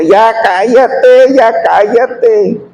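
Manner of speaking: high, loud and very slow 'ya cállate'; a child pleading loudly with a crying baby to hush; exasperated shushing. Retold or imitated. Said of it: "que le decía".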